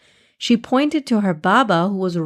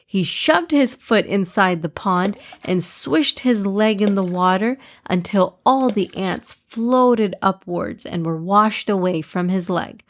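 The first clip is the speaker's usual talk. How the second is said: usual speaking voice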